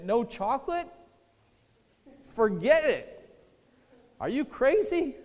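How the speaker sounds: high, loud disbelief, recoiling from the idea; incredulous refusal. Retold or imitated. Sick friend balking at giving up chocolate, incredulous. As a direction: scoff at it like it's insane.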